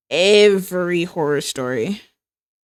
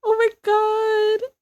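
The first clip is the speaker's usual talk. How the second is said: high, slow, amazed oh my God; awed gasp; 13 semitones higher, slower than usual. Real, not acted. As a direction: marvel at it, breathless. Awed reaction to drawing.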